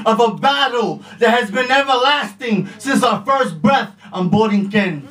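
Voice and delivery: rhythmic spoken word performance; slam poetry. Acted.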